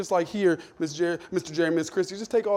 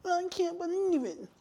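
mock shocked member; 13 semitones higher, 8 dB quieter, slower than usual. Mocking a member.

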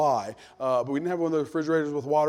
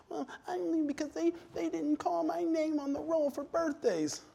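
whiny churchgoer; 11 semitones higher, 10 dB quieter than usual. Retold or imitated. Mocking leaving member.

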